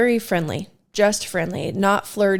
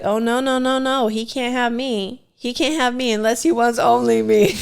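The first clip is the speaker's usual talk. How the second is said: mock refusal; 4 semitones higher than usual. Acts out her possessive refusal.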